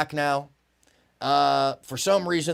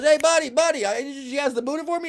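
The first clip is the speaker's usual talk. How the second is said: put-on cheery drunk calling; 11 semitones higher than usual. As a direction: call out like a happy drunk at a friend. Drunk calls to traveler.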